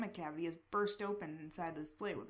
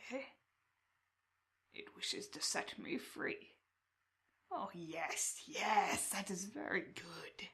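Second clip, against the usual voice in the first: slow raspy creature; slower than usual. Acted. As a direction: creep it out slowly like a chained crow man. Crow man voice again.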